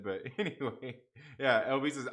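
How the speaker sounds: ordinary delivery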